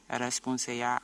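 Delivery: usual speaking voice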